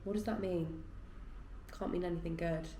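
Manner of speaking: normal talking voice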